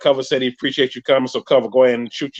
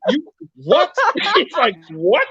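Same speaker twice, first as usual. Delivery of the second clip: mock disbelief; 12 semitones higher, much slower than usual. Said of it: "He messaged me right now like".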